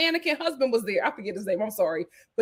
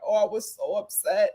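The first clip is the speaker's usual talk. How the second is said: ordinary talk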